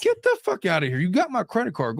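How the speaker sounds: incredulous, emphatic brush-off; indignant dismissal. Acted. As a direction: send your spouse packing in mock outrage. Acted rebuke to imagined spouse.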